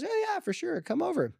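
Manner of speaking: high-pitched, eager mom's yes; retold mom agreeing in a light, high voice; breezy agreement. Mom tells daughter her friends can come, breezy agreement.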